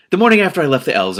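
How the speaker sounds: plain reading voice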